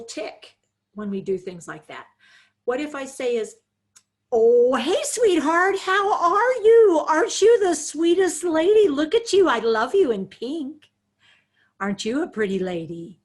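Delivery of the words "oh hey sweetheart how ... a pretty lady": high, loud, sugary talking-down voice; patronizing sweet talk. Shown as a demonstration. Caregiver talking down to Rachel in a sing-song baby voice. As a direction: gush at her like she's a small child. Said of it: "What do you find annoying about me?".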